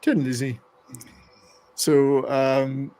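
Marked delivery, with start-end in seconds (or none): 0.0-0.6 "Tin" said with a hard t, no th sound; "[name]" the Irish way, "Thin" said as "Tin". Shown as a demonstration. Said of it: "they would still pronounce it tin".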